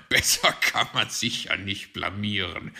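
loud mocking chuckle through the words; sneering, amused fox; laughing. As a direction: jeer with laughter breaking in. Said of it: "lachte Herr Fuchs".

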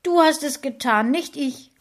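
wife accuses husband near tears, stressing DU. A narrator's reading. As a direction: throw the blame at him, close to crying. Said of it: "fast weinend sagen".

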